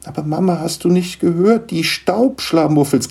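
put-on high, urgent child's plea; pleading protest. Niklas begs his mother to listen, pleading. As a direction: protest to mum, stretching the last word.

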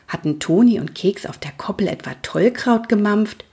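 plain reading voice

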